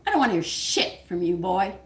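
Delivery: usual speaking voice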